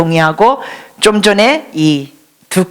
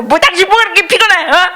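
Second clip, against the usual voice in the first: angry husband; 10 semitones higher, faster than usual. Acted. Pastor acting out a husband berating his wife, hammed-up rage. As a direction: fire it at her like an outraged husband. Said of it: "막 화를 내는 거예요".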